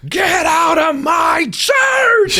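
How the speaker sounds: high, slow, booming roar; furious shout. Retold or imitated. Friar throwing the sheriff out of church, roaring. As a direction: let the friar finally explode. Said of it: "the dude just blows up".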